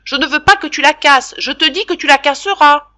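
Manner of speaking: loud, high and final; no-reply tone. Acted. Father cutting off Jacques's protest, loud final tone. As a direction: shut down any answer. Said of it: "d'un ton qui n'admet pas de réplique".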